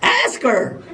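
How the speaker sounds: high-pitched imitation of an urging little girl; little daughter. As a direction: plead like a small child nudging Daddy. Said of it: "my daughter comes running in".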